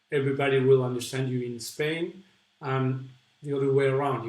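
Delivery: usual speaking voice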